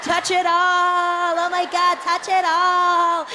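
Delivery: gushing tourists. Acted. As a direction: swoon over every little thing. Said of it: "tour group were like".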